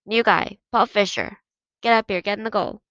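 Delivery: loud yell across the field. Acted. Coach yelling down the field at the new goalie, loud yell. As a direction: call him up the field, loud. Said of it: "The coach yelled down".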